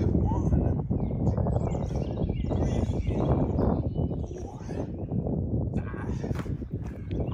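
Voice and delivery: strained, breathy counting while lifting; doing pull ups. Real, not acted. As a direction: count reps through the effort of pull-ups. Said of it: "Do as many pull-ups as you can".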